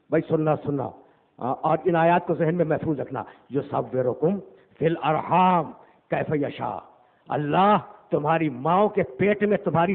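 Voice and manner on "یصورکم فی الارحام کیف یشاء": Quran recitation. Acted. Quranic verse recited in sermon.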